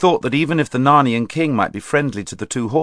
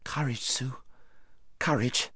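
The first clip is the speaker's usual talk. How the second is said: soft whisper; 2 semitones higher, 11 dB quieter than usual. Acted. Brother comforting crying sister.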